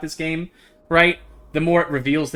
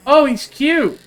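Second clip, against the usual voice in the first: high, loud delight; excited gushing; 11 semitones higher, 10 dB louder, slower than usual. Real, not acted. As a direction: squeal over the cute goat. Sees goat, gushes.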